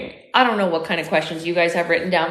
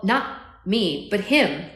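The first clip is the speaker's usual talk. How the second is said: plain reading voice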